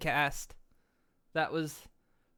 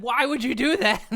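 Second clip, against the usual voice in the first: higher, louder yelled question; higher, louder playful yell; mock shout; 6 semitones higher, 9 dB louder than usual. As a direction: shout "Why would you do that?" in mock outrage. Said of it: "I said".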